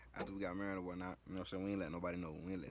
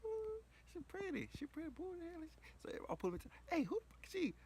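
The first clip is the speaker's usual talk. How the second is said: relatives' voices; 4 dB louder than usual. Retold or imitated. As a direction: play curious aunties at a cookout. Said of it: "and everybody like".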